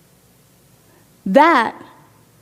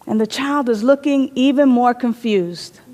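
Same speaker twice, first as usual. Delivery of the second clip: ordinary delivery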